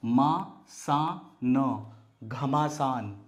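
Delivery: syllables stretched apart; slow sounding out. Shown as a demonstration. Teacher stretching a word out for beginners. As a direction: sound out each syllable slowly. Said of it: "ये बना घ".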